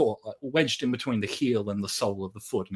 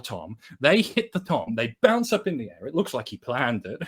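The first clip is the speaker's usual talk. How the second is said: ordinary delivery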